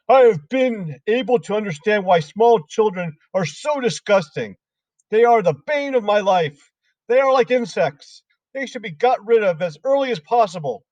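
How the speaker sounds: a nasty headmistress mocking small children; Trunchbull voice. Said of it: "she said".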